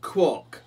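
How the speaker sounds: "Quack." said as "kwock", high and loud; loud duck call with a round "o" in "Quack."; "Quack." barked out to rhyme with clock; quack with borrowed O. Acted. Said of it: "took the ah from the dug".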